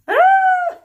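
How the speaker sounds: very high, loud scream; pained scream. A narrator's reading. Hairdresser's fingers getting munched, high pained scream. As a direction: let out a piercing acted shriek. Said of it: "screamed Monsieur Joseph".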